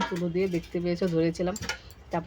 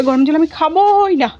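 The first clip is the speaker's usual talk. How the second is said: slow, louder mock stubborn child; child's refusal; 8 semitones higher, 10 dB louder, slower than usual. Mother retells son refusing hot water, mock stubborn.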